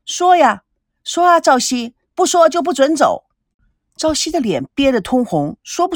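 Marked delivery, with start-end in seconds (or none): 0.0-3.2 high, loud goading; clamouring crowd. A narrator's reading. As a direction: press him like noisy onlookers. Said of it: "大家七嘴八舌". (七嘴八舌地起哄)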